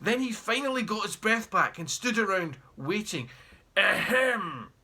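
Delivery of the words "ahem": a pointed throat-clearing ahem; pointed cough. Acted. Taxi driver waiting for a tip, clearing his throat pointedly. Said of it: "he coughed".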